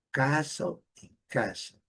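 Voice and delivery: castle with open A, then with cat-like A, no T; British then American castle. Shown as a demonstration. Teacher compares both versions, slow vowel demo. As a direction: slowly contrast the two vowels in castle. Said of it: "a melhor pronúncia é".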